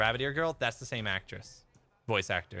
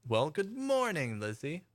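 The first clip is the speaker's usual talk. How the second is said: high, drawn-out good morning; effusive greeting; 7 semitones higher, slower than usual. Real, not acted. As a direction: sing out the greeting high and slow.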